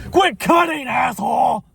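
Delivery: high furious shout at a line cutter; angry yell. Real, not acted. Driver yells at a car cutting the line, furious shout.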